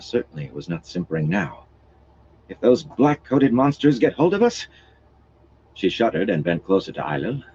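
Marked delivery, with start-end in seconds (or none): 2.5-4.7 afraid. Acted. Frightened noblewoman speaking.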